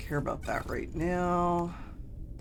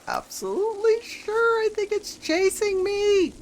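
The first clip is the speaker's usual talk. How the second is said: excited; 6 dB louder than usual. Real, not acted. Storm chasing, raid greeting.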